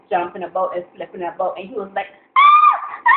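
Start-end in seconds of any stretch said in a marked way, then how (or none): 2.4-3.1 high, loud mock squealing; girly scream. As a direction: imitate a panicked high-pitched shriek. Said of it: "I would be the person screaming like a girl".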